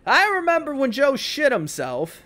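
mock speech. Imagined wedding speech quote.

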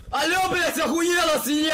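angry yell. Acted. Roleplay cop screaming at a captured suspect, furious shout. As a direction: bark it out like an enraged cop grabbing someone.

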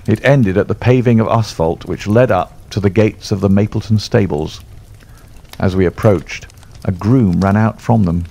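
ordinary delivery

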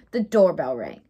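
plain reading voice

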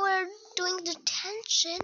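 normal talking voice

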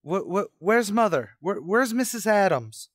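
high, stammering panic; frantic stammer. Acted. Son on the phone demanding his mother, high frantic stammer. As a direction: stumble over each word in fear.

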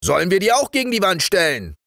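high, fast soldiers' question; quick high-voiced shout to the sergeant; soldiers calling out. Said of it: "rief die erste Kompanie".

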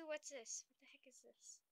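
hushed whisper to herself. Real, not acted. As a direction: whisper it curiously. Whispered at game item.

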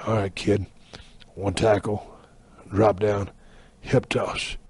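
very low, soft, paused phrases; horsefly calling spots. Acted. Horsefly repeating wrestling spots to his captor, low and soft. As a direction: repeat the moves quietly under your breath.

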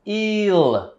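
"Ill." with lips stretched wide; wide-mouthed ill. Shown as a demonstration. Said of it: "stretch your mouth".

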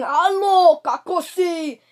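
imitating dad screaming. Vlogger mimics dad screaming hello from another room, mock yell. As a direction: holler it out like dad on a call. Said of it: "my dad is now screaming".

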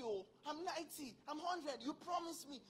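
usual speaking voice

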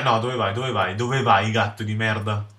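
ordinary delivery

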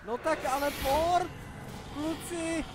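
high, loud panicked shouting at teammates; panicked yelling. Real, not acted. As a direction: yell in real panic as the fight goes wrong. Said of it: "že řvu". (panický řev na spoluhráče)

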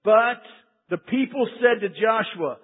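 ordinary talk

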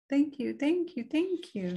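high, soft singsong thanks; grateful villagers. Acted. Villagers thanking Boy, high and soft.

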